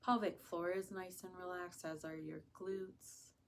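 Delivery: soft, unhurried meditation voice; hushed, soothing guidance with long pauses; guided meditation. Acted. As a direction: ease them gently into relaxation, softly.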